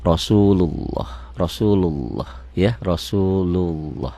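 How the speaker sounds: "Rasulullah" slowed, the "lo" rounded as if a W sits in it. Shown as a demonstration. Teacher drilling thick Allah for parents. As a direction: repeat "Rasulullah" slowly, rounding the lo. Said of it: "seperti ada W-nya gitu".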